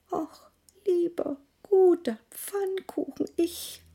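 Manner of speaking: hohe, schwache Kinderstimme; erschöpft abbrechend; mattes Kind. Acted. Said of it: "so hungrig und matt". (schwaches, hungriges Kind)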